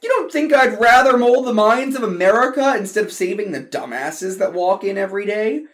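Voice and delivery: high loud exasperated retort; heated. Acted. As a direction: fire back at your brother. Brother argues back.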